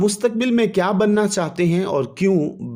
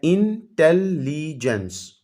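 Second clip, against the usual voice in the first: Intelligence said slowly, split into its four syllables; teacher stretches intelligence out to show its syllables; syllables spelled out; much slower than usual. Shown as a demonstration. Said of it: "intelligence four syllable word है". (Intelligence को धीरे धीरे चार हिस्सों में बोलें)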